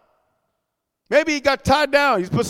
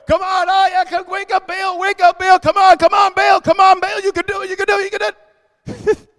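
high-pitched mock pleading, urgent and repetitive; mocking cheering; 7 semitones higher than usual. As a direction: egg on a sleeping god in mockery. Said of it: "He's gonna mock them. That's what I'm doing".

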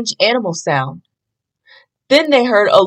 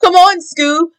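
shout; 8 semitones higher than usual. A narrator's reading. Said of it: "shouted Shaggy".

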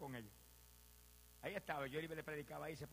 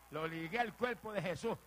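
louder, slow proclamation; emphatic preaching; 3 semitones higher, 7 dB louder, slower than usual. Real, not acted. Preacher claiming his father for Christ, emphatic preaching. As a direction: declare it with authority, word by word.

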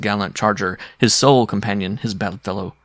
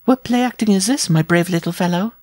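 much higher, teasing big woman; amused giantess; 10 semitones higher, 3 dB louder than usual. Acted. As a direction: tease the little suitor, high and amused. Giantess mocks the dwarf.

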